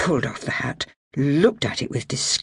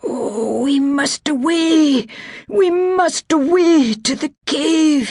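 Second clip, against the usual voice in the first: put-on high, slow, urgent cry of a wounded old laird; cried out; 13 semitones higher, 5 dB louder, slower than usual. A narrator's reading. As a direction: plead it out, much higher and drawn out. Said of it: "he cried".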